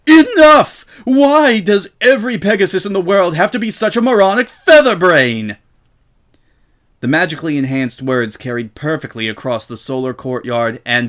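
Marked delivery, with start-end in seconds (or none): 0.1-5.6 much higher and louder, full-force screaming. A narrator's reading. Shop owner screaming at Rainbow, all control gone. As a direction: blast it out in total fury. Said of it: "Miss Vinton screamed".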